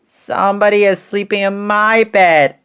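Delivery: high tiny bear voice; wee little voice. A narrator's reading. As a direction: squeak it out as an astonished cub. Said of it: "in his wee little voice".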